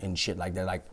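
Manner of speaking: low, hushed confidential retelling; soft, discreet near-whisper. Retold or imitated. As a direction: drop the voice, keep it between us. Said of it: "I'm actually whispering to you".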